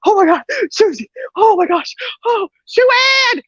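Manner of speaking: high-pitched mock-panicked grandma; retold frantic yelling. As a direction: cry out for someone in alarm. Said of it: "She yells out for my mom".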